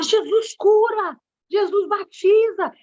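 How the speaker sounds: slow, high, proclaiming each word; to the congregation, declaring healing in a slow, high preaching voice; preaching declaration. Retold or imitated. Retelling her own preaching declaration.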